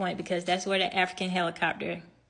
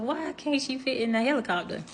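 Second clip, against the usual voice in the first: higher-pitched puzzled question; confused inner protest; 5 semitones higher, 3 dB quieter than usual. Retold or imitated. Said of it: "I'm just still confused".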